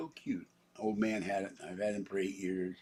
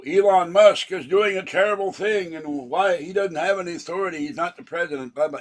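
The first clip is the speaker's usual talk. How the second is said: mimics the critics in a higher, louder, mocking voice; mocking parody; 9 semitones higher, 10 dB louder than usual. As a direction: rattles off their claims in a high, scornful tone.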